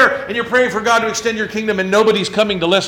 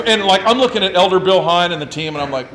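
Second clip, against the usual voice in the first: ordinary talk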